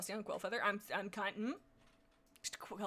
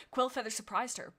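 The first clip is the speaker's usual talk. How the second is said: plain reading voice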